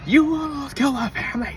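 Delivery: high, hard threatening tone; retold menace at the driver; sharp rock man. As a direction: cut him down like jagged rock. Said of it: "jagged stone man".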